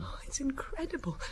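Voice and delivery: a soft, unvoiced, disbelieving whisper; stunned whisper. Sister whispering in shock at the warrant news. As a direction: barely breathe it out in shock.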